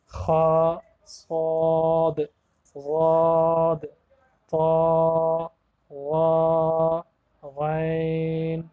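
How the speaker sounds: خا صاد ضاد طا drawn out slowly with full rounded mouth; teacher showing heavy Arabic letters. Shown as a demonstration. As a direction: stretch each heavy letter long and full. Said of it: "موٹے پڑھے جانے والے حروف".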